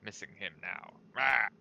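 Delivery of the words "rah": short barbarian war cry; rage yell. Acted. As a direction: bark it out like a battle roar. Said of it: "I'm just gonna rage at my".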